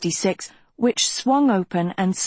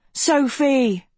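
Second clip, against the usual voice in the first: higher, insistent call; urgent calling out; 6 semitones higher than usual. A narrator's reading. Agatha pounds Sophie's door harder, urgent call. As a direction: call through a shut door, more insistently.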